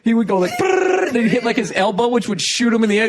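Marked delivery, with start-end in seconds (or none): 0.6-1.1 rolling noise. Retold or imitated. Imitating the rolling skater.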